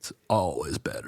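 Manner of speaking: low lazy surfer voice; surfer dude voice. Shown as a demonstration. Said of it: "you're the turtle from Finding Nemo".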